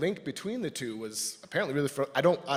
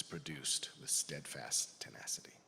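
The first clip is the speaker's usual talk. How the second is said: whispered; 11 semitones lower, 12 dB quieter than usual. Acted. Speaker praising tenacious workers to congregation, whispered. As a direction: drop to a hushed whisper.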